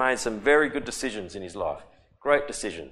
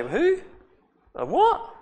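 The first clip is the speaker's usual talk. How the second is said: high, incredulous squeak of surprise; astonished king; 4 dB louder, slower than usual. Retold or imitated. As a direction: play the baffled king, loud. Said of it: "Even the king didn't even say".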